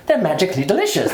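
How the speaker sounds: high, sing-song stage-Irish; hammed-up high Irish accent; leprechaun Irish accent. Shown as a demonstration. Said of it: "they always want that leprechaun".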